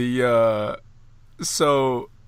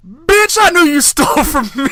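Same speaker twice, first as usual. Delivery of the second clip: high, loud comic shout of accusation; hammed-up angry uncle yelling at the woman; mock furious yell; 11 dB louder than usual. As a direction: scream it like he caught her red-handed. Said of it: "Cat Williams goes".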